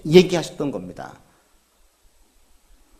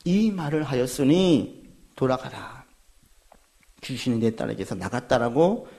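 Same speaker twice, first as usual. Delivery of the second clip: calm pronouncement; 7 semitones lower, 8 dB quieter than usual. Acted. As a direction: speak as Jesus, gently final. Jesus's answer voiced. (낮고 느리게 엄숙하게)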